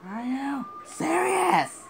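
high, loud, slow wailing shout; anguished cry. Real, not acted. Gamer losing the car, anguished cry. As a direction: wail it out in despair.

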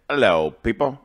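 shaky attempt at a British accent. Vlogger retrying the greeting in a put-on British accent. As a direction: try out a British hello. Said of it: "I need to work on my British accent".

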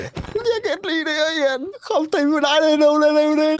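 high-pitched panicky nej nej nej; frightened gamer yelling at his friend as something appears in the cave; scared panic. Real, not acted.

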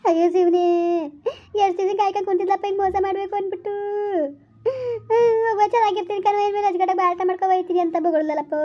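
comic tearful wailing, loudest at the start; wailing lament. Acted. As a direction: wail to the heavens over the family shame. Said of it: "ಯಾಕ್ ಹಿಂಗ್ ಅಳ್ತಾ ಕುಂತಿದ್ರಿ?". (ಗೋಳಾಡುತ್ತಾ ಅಳುವ ಧ್ವನಿ)

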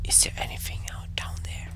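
scared, quick whisper. Real, not acted. Player peering into the dark game, whispering. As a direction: drop to a voiceless whisper. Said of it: "I'm whispering".

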